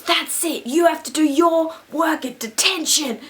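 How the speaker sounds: loud, high imitation of an angry teacher. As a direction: lay down the law, loudly mimicking him. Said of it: "anytime anyone raised their voice".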